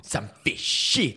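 slow Parisian French accent on a grumble; fed-up French complaint, drawn out; lightly done France French accent. Shown as a demonstration. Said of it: "Ça, tu y arrives bien, ça".

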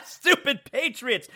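slow, soft, still laughing; mock them through a laugh. Real, not acted. Mocking rival team, laughing.

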